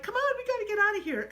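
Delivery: high, loud shrieking voice; shrieking friend. Retold or imitated. Jenny fleeing the grotto, shrieking high. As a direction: squeal it like a scared girl.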